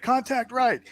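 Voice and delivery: high-pitched mock combat call; high, urgent warning shout, acted; acted battle call. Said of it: "So he's just like".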